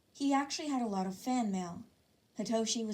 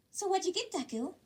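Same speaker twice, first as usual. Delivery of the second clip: put-on high, curious girl's voice; high eager schoolgirl voice; girlish Uraraka voice; 10 semitones higher than usual. A narrator's reading. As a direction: lift it up as a bubbly girl.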